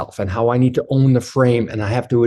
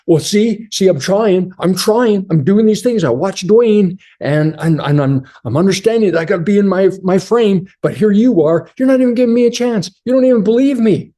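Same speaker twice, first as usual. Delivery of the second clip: higher, rushed, pleading whine; whiny little boy; 6 semitones higher than usual. Retold or imitated. Husband whining to his wife that she won't give him a chance. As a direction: plead like a hurt little boy who feels unfairly judged. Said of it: "revert right back to the little boy".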